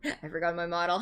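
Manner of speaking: ordinary talk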